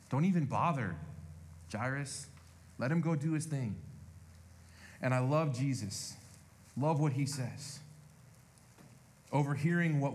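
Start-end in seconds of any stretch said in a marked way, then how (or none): none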